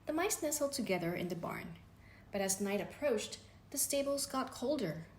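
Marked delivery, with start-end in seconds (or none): none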